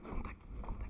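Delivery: hushed urgent whisper; whispered callout. Real, not acted. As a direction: breathe the warning low. Calling contact in airsoft game.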